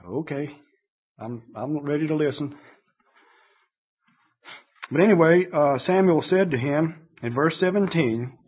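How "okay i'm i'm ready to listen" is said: meek reply. Retold or imitated. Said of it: "maybe Saul said".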